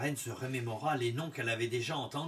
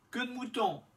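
higher, hopeful guess; queen's voice; 10 semitones higher than usual. A narrator's reading. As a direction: ask the name hopefully, higher. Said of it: "elle fit demander".

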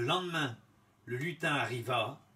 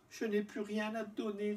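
put-on higher, softer young girl; girl's voice; 8 semitones higher, 3 dB quieter than usual. A narrator's reading. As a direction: answer helplessly, higher. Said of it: "répondit-elle".

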